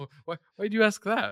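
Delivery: high, loud startled question; startled disbelief. Retold or imitated. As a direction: react in shocked disbelief. Acting a character's confusion.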